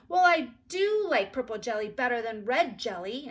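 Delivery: put-on loud, stubborn child; insistent. A narrator's reading. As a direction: dig in her heels. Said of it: "insisted Ramona".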